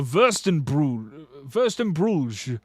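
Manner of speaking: drawn-out German-style word; mock-German pronunciation, stretched out; German accent. Shown as a demonstration. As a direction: say it slowly like a German. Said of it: "saying it like a German".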